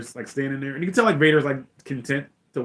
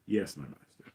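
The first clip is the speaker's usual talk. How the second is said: low, soft submissive reply; obedient Vader; 7 semitones lower, 10 dB quieter than usual. Retold or imitated. Vader yields to the Emperor, obedient and low. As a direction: answer the master humbly, voice dropped. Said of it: "he's obedient to the Emperor".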